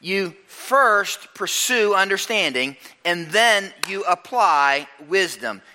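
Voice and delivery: slow emphasis. Shown as a demonstration. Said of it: "restating key maxim".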